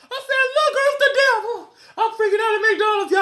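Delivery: very high, loud laughing freak-out. Real, not acted. Retelling the McDonald's panic to viewers, squealing and laughing. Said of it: "I was freaking out at McDonald's".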